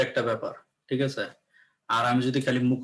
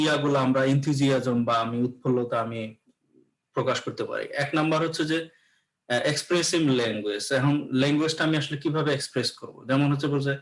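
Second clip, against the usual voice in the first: ordinary talk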